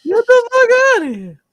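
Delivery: loud, very high put-on shout; high-pitched echo. Echoes the mimicked line, louder.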